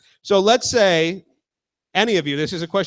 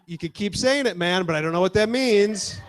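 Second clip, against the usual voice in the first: normal talking voice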